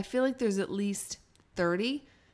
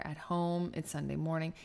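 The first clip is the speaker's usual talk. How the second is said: normal talking voice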